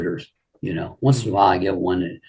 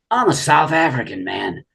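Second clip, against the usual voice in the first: proud, higher South African drawl; imitating Ron's drawl; 7 semitones higher than usual. Ron proudly revealing his homeland, mock drawl. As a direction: announce it with swagger in his drawl. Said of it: "you know that drawl he had".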